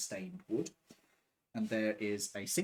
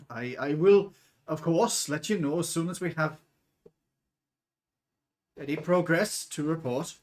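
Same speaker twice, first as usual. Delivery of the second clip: nervous dwarf, higher and louder, halting; terrified; 4 semitones higher, 7 dB louder than usual. Acted. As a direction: stammer anxious promises to a feared boss. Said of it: "That's the sound of him shitting himself".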